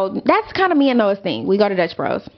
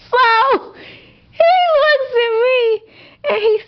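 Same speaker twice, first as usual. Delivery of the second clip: higher pitch, laughing through it; 13 semitones higher, 3 dB louder than usual. Real, not acted. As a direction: crack up while telling it. Laughing hard at the memory.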